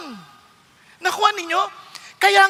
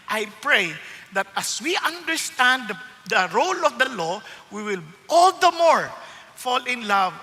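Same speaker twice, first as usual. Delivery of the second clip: prayer; 7 semitones lower, 5 dB quieter than usual. Acted. Opening prayer in sermon.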